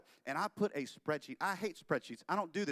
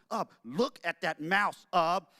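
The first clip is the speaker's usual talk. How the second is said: louder, slow and eager; excited; 3 semitones higher, 8 dB louder, slower than usual. Acted. Walt showing Ub the mouse, excited. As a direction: show off the mouse with growing glee.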